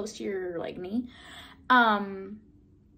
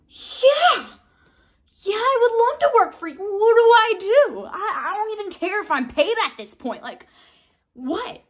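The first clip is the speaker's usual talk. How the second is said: much higher, louder, thrilled shout; ecstatic yes; 10 semitones higher, 8 dB louder than usual. Retold or imitated. Accepting the casting director's job offer, thrilled high shout. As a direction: burst out with overjoyed acceptance.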